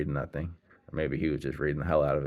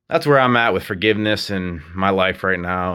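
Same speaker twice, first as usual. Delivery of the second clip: emphatic loud statement; 3 semitones higher, 12 dB louder than usual. Real, not acted.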